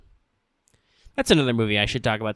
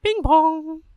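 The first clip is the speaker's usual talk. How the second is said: nerdy voice; 5 dB louder than usual. Pretend. Mock nerd voice sound.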